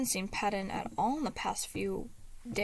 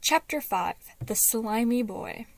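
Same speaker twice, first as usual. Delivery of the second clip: ordinary delivery, nothing marked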